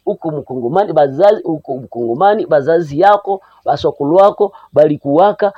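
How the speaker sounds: usual speaking voice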